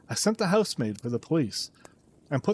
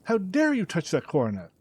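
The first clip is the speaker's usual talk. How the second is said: raised, accusing cries; angry scream; 5 semitones higher than usual. A narrator's reading. Father accusing his son of theft, angry shouting. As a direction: hurl the accusations at him. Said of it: "I screamed".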